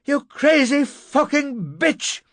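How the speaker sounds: high, drawn-out yell; furious shout. A narrator's reading. Narrator punching the locked door, furious shout. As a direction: rage at her through the door. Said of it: "I shouted".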